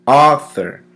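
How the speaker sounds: "Author" with a loud, punched AU; stress on first syllable. Shown as a demonstration. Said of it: "The emphasis is placed on the first syllable".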